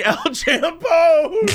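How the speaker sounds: high, slow yelled El Champone; mock scream. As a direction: scream El Champone across the parking lot. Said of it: "in the parking lot screaming".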